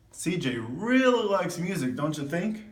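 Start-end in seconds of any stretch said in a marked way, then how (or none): none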